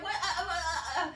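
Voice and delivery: high, stammering mock panic; comically overwhelmed sputtering; flustered panic. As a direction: stumble helplessly over the words.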